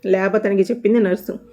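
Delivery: plain reading voice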